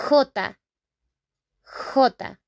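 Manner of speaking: "J" named as HO-tah; Spanish letter name. Shown as a demonstration. Teacher naming the letter in Spanish, "J" as throaty HO-tah. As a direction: say "J" as jota with throaty h. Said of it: "in Spanish we call that".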